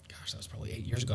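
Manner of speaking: breathy whisper under the breath; whispered aside. Real, not acted. Aside about time passing.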